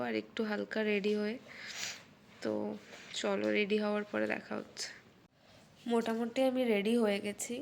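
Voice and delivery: soft hushed talk; quiet voice. Real, not acted. Just woke, speaking softly.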